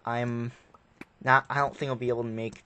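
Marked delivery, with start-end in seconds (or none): none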